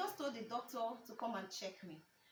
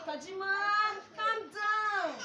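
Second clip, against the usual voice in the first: lightly voiced dad, higher and louder; dad's voice; 8 semitones higher, 8 dB louder, slower than usual. Dad calls his angry daughter, high and insistent. Dad soothing her, drawn out loudly.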